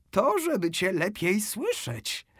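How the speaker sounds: the wolf hiding behind grandma's voice; wolf in high voice. A narrator's reading. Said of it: "powiedział wilk wysokim głosem". (wilk mówi wysokim, powolnym głosem babci)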